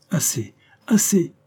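mild narrated cry. A narrator's reading. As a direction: call out to halt the player. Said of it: "lui criait par moments le comte".

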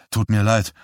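usual speaking voice

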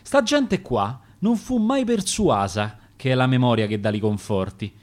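ordinary delivery, nothing marked

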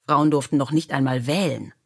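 usual speaking voice

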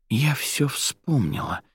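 hushed-wonder realisation; sudden realisation. A narrator's reading. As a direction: let the memory dawn, drawn out. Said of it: "пронеслось в голове у Арадии".

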